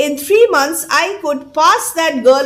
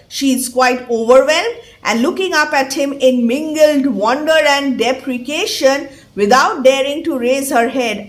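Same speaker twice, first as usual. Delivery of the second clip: ordinary talk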